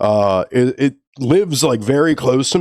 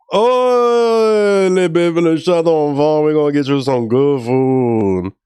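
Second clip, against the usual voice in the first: put-on high, drawn-out Creole accent, slowing at the end; hammed-up Louisiana Creole singsong, coaxing; 4 semitones higher, slower than usual. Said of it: "a really Creole way".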